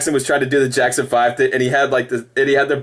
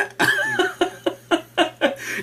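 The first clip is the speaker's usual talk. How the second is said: high shrieking burst of laughter; 5 dB quieter than usual. Real, not acted. Shrieking laugh at own joke. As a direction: squeal with laughter.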